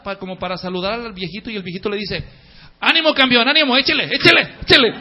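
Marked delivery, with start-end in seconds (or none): 2.8-4.9 put-on loud, high cheer of an old man egging on; cheering on a runner. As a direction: shout encouragement from the doorway. (ánimo gritado con entusiasmo)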